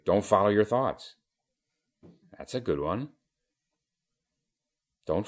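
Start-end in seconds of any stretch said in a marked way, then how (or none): 0.1-1.2 higher, louder, firm quoted maxim; simply stated advice. Retold or imitated. Teacher quoting another teacher's advice to the student, said simply. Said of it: "another teacher said simply".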